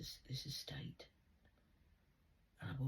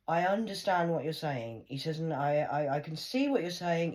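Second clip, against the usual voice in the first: booming, much louder authority; commanding loud voice; 4 semitones higher, 9 dB louder than usual. Retold or imitated. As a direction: lay down the law over the staff. Retelling the big man's ruling.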